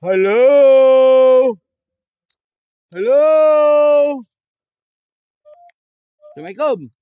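loud, high call into the dark. Real, not acted. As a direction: shout a greeting to the spirits. Calling out to spirits in dark.

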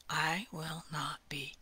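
slow, fading whisper; slow whisper fading to nothing; whispered resolve. Acted. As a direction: breathe the words almost silently. Closing quiet declaration.

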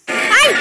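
loud shriek of fright; jump-scare yelp; startled scream. Real, not acted. (grito de susto)

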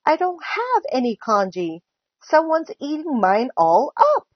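rising storybook distress; childish wail. A narrator's reading. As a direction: wail it like an upset little kid. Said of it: "Little Chan wailed".

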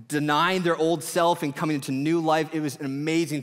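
lifted preaching energy; enthusiastic preaching. Acted. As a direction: share the baptism with excitement.

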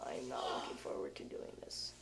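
soft unvoiced whisper. Real, not acted. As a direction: whisper it under your breath. Whispered aside before drinking.

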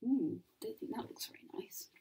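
hushed aside; soft unvoiced aside; whisper. Real, not acted. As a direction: whisper it to the little listeners.

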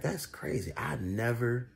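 ordinary delivery

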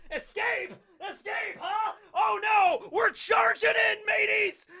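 high screeching disbelief building to a battle cry; crazed pirate captain. Acted. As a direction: scoff shrilly, then rally the crew like a madman. [name] answers as the insane captain.

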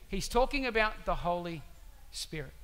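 ordinary delivery

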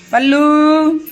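high, loud calling out; dramatic loud aunty. Real, not acted. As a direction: call the pet across the house. Calling out to the dog.

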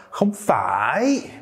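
"phải" drawn out, dipping then rising high; intense rejection. Shown as a demonstration. Teacher showing intense rejection, "không phải" with stretched rising ending. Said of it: "a much more intense sort of rejection".

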